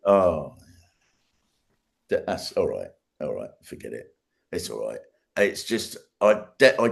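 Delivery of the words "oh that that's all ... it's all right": calming self-talk. Retold or imitated. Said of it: "And then I thought".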